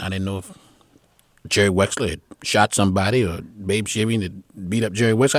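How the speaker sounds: usual speaking voice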